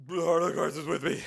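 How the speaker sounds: loud, high, fast declaration; hammed-up battle cry. Streamer declaring faith in the cards, hammed-up battle cry. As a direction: belt it out like a rallying cry.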